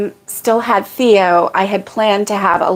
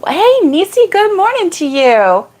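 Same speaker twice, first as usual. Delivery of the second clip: high, cheery hello; bright greeting; 9 semitones higher than usual. Real, not acted. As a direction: sing out a morning greeting. Greeting a viewer.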